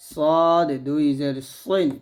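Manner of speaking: "সদ" and "সইন" with a thick, emphatic s; thick ص sound. Shown as a demonstration. Teacher drilling the letters, thick s in "সইন". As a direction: say "সইন" with a heavy, full s. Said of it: "মোটা হবে".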